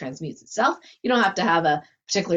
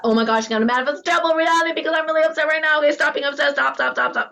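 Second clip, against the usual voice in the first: mock panic; 7 semitones higher, faster than usual. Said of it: "worry not about".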